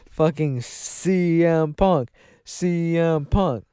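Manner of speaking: slow, stretched announcer call; drawn-out ring announcer. Acted. Host hyping the crowd, slow announcer call.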